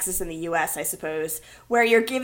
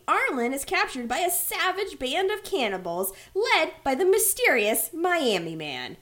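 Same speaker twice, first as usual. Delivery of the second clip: ordinary delivery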